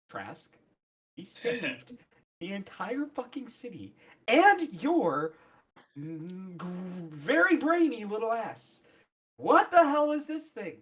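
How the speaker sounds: through gritted teeth. Acted. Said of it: "you say this through gritted teeth, but quietly".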